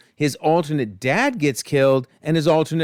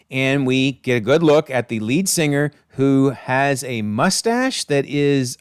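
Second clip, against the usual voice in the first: ordinary talk, nothing marked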